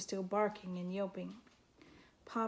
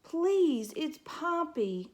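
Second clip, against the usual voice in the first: higher and louder, pleading correction; insistent little mouse; 7 semitones higher, 5 dB louder, much slower than usual. A narrator's reading. Mouse correcting the porcupine, pleading. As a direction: insist on her name, pleading.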